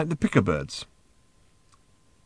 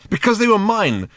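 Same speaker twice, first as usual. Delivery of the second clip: louder, higher cross reply; 12 semitones higher, 6 dB louder than usual. Acted. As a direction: answer crossly, a little offended. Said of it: "said Ford crossly".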